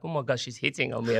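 fast hushed thought; rushed inner panic. Retold or imitated. At the club, thinking a girl is hitting on him, rushed and hushed. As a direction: blurt the worried thought quickly under the breath.

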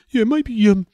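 high mock note-giver voice; lilting executive giving notes; TV exec voice. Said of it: "people in charge give you notes".

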